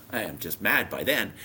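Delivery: ordinary talk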